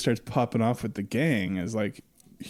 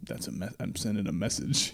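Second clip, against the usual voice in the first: soft, low deadpan; deadpan murmur; 3 semitones lower, 7 dB quieter than usual. Retold or imitated. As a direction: say it quietly, unbothered. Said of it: "And he's like".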